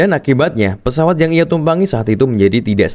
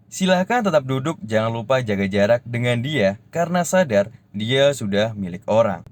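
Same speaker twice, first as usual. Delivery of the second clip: soft announcement poem; 7 dB quieter than usual. Acted. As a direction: recite softly like a heartbroken flight attendant. Heartbreak poem as cabin announcement over music. (pengumuman pramugari yang pelan dan sendu)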